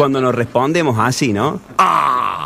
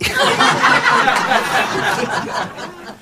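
real laugh; 9 semitones higher than usual. Real, not acted. Laughs at own punchline.

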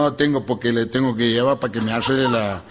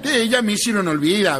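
ordinary talk, nothing marked

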